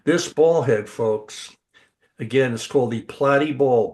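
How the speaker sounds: ordinary talk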